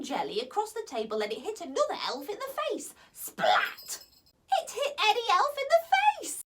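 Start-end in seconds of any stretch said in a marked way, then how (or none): none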